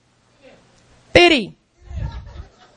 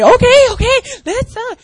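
eager friend; 11 semitones higher, 6 dB louder than usual. Retold or imitated.

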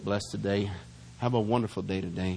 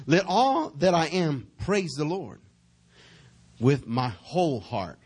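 preaching; 4 semitones higher, slower than usual. Real, not acted. Preacher reading scripture with force.

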